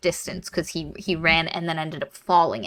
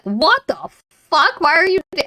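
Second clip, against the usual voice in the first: retold bafflement; 9 semitones higher than usual. Watson's baffled reaction, retold.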